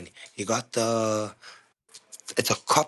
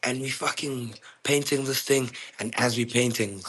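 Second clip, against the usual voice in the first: ordinary delivery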